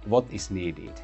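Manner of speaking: usual speaking voice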